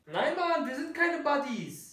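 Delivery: high indignant denial. Acted. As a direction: protest the accusation. Denying the accusation.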